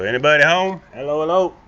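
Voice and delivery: loud, high hello to anyone inside; calling down a hallway. Real, not acted. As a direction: call out into the house. Calling out entering a house.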